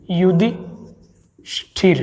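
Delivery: युधिष्ठिर stretched very slowly, long ई clear; slow careful spelling. Shown as a demonstration. Teacher slowly modelling युधिष्ठिर so students hear the ई. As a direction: drag out युधिष्ठिर syllable by syllable. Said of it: "ई आएगा साथियों".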